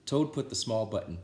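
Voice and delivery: storybook reading. A narrator's reading. Reader telling Frog and Toad to children, lively storybook voice. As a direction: read it like bedtime story time for kids.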